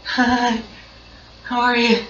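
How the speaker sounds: high, timid little greeting; shy awkward hi. Retold or imitated. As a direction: mimic your shy teenage self saying hi. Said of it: "this is really how I talked with her".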